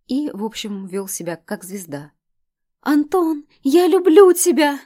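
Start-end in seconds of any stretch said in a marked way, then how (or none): 2.8-4.8 high piercing scream of love; ecstatic fan scream. A narrator's reading. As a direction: shriek it at the star. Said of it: "От такого выкрика".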